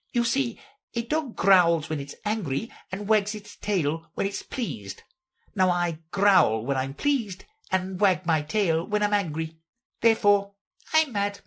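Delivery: high-pitched cat character, explaining; Cheshire Cat reasoning. Acted. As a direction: lay out mad logic in a high voice.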